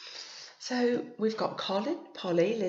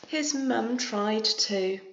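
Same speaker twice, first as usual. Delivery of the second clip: story narration; 3 semitones higher, 5 dB louder, much slower than usual. A narrator's reading. Reads picture book aloud.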